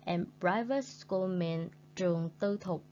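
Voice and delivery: ordinary talk, nothing marked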